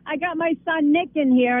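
usual speaking voice

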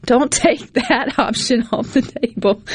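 chuckling through the words; laughing. Real, not acted. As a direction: say it while laughing. Laughing while advising listeners.

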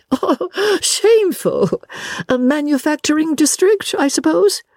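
very high, theatrical indignation; scandalised lady. Acted. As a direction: draw out the shock, then inquire grandly. Miss Lavish is scandalised.

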